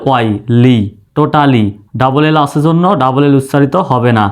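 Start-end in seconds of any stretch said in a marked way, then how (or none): none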